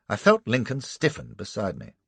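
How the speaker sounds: ordinary delivery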